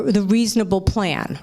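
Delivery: ordinary delivery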